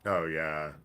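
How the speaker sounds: ordinary delivery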